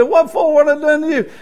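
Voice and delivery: high, startled little question; retold surprise. Pastor retells his surprised reply.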